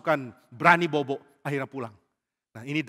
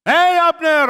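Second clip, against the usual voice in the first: high, loud holler; full-voiced shout across a distance; teasing shout; 14 semitones higher, 14 dB louder, much slower than usual. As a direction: call loudly to rouse a sleeper. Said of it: "Dia mulai teriak".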